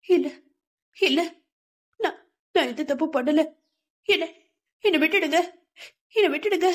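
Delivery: a mild acted cry of terror in her sleep; frantic pleading. A narrator's reading. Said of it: "வெறி பிடித்தவள் மாதிரி அலற".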